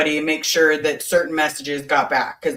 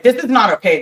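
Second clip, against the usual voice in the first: louder, higher re-enacted telling-off; nasty reprimand; 6 semitones higher, 6 dB louder, faster than usual. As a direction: hit them with a loud, pitched-up rebuke. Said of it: "I was more like".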